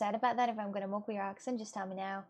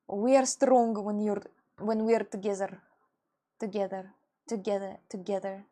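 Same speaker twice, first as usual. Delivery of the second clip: Sova impression; 4 dB louder than usual. Streamer trying to recall how a Valorant character said it, Sova impression. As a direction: have a tentative go at a game character's voice. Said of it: "he said that somehow".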